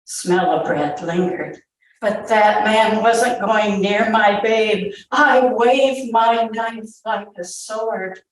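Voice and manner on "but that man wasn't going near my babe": plain reading voice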